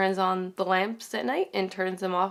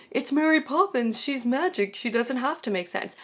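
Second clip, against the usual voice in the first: high-pitched mock protest, easing down; mock objector; 5 semitones higher than usual. Reviewer pre-empting viewers' excuses for the plot, mock protest. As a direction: imitate an objecting fan in a raised voice. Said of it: "Now, don't start telling me".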